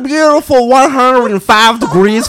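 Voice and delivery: loud, slow, hammed-up Southern drawl; stretched-out cowboy-style American accent; fake Southern accent. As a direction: play a mock porch-sitting Southerner. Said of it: "Southern accents and stuff".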